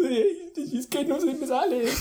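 high, slow, half-laughing whine; mock whiny child laughing. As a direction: whimper it like a frustrated kid. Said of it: "Y yo".

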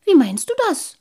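high, loud, innocent question; puzzled little water sprite. A narrator's reading. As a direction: ask back in bewilderment.

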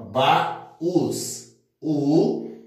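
"baús" said ba... ús, with a pause; teacher dividing "baús" as ba, then ús; split into syllables. Shown as a demonstration. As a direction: break "baús" into two separate syllables. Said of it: "eu vou dividir aqui".

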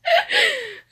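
shrill laughing whimper; high squealing sigh. Real, not acted. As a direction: let out a piercing squeal. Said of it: "that must have been a very uncomfortable pitch".

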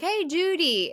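high, sing-out mock call. Ricky calls out to a girl again, imitated high call. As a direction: imitate calling to someone at a distance. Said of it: "Ricky calls out to her".

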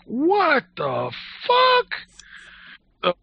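very slow, high drawn-out disbelief; stunned brother. Retold or imitated. Brother watching from his car, slow high disbelief. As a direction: stretch it out, mock amazed. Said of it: "my brother was just looking like".

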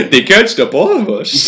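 goofy cartoon dad, laughing through it; high, loud Homer impression with giggles; Homer Simpson voice. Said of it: "it's like doing the Homer Simpson voice".

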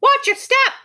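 high, loud bird squawk. A narrator's reading. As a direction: screech it out like a startled bird. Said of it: "squawked the wiffle bird".

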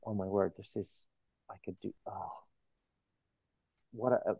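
softly, under the breath, stunned and marvelling; a whisper-soft "oh my word", lost for words; hushed awe. Retold or imitated. Author recalling finishing the first chapter, hushed awe.

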